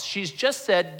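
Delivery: ordinary talk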